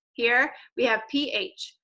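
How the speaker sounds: slow letter naming P H; P H spelled out letter by letter; spelled digraph. Shown as a demonstration. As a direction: say each letter slowly. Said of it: "Practice with me".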